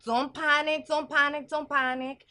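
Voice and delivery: high, loud mock calming; frantic fortune teller. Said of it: "I'm a fortune teller".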